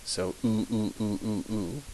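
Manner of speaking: soft oo, oo, oo repeated; repeated vowel drill. Shown as a demonstration. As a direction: drill the oo sound quietly. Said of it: "the U is always oo".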